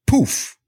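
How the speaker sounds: poof sound effect. Shown as a demonstration. His chosen poof sound effect.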